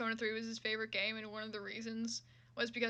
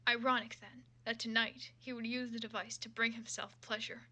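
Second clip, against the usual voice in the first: steady dramatic reading of a fan fiction; story narration; 5 dB quieter than usual. Acted. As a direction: read the prose like an audiobook. Resumes reading fanfic narration.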